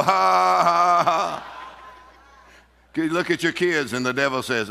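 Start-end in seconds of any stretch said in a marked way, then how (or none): 0.0-1.4 loud, high, long ha's; defiant demonstrated laugh. Shown as a demonstration. Answering the devil about a new car, forced laugh. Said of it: "You go".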